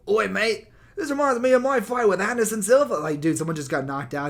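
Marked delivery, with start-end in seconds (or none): 0.1-2.9 high, loud, mock British accent; mock British Bisping. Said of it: "turning every single moment into a memory".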